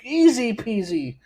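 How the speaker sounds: hammed-up infomercial voice; mock ad read. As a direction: hawk it like a late-night TV salesman. Parody ad read for knife sharpener.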